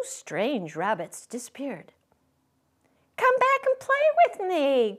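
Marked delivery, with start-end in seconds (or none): 3.2-5.0 high, loud pleading call; calling after them. A narrator's reading. Little rabbit calling after the fleeing rabbits, high and pleading. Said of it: "called the little rabbit".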